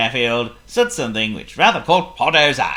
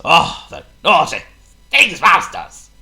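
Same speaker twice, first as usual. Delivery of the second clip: exclaiming; 5 semitones higher, 6 dB louder, slower than usual. A narrator's reading. Narrator exclaims about mouse.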